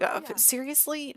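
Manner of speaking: high-pitched mock exasperation; put-upon, incredulous dad; exasperated disbelief. Dad balks at raising child.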